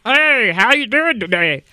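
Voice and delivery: a hint of a puppet show, same voice as yours; imitating Todd Oliver. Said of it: "He would say".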